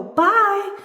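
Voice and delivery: high, loud bye; high-pitched parting yell; yelling goodbye. A narrator's reading. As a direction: shout a hurried farewell while racing off. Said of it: "yells the little spirit doll".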